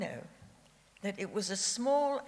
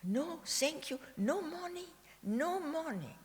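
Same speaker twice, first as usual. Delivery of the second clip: put-on higher, softer, in a lightly done thick Austrian German accent; 9 semitones higher, 4 dB quieter than usual. Father refusing the porter, in a lightly imitated thick Austrian accent. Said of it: "with his thick accent that he never really lost".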